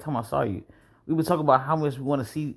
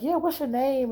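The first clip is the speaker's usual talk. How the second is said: imitated friends; 10 semitones higher, slower than usual. Studs quizzing him, imitated.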